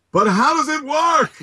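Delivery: high mock dad voice in a funny accent; imitating his dad. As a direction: ask it like a bewildered father at the computer. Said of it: "why you're talking with that accent".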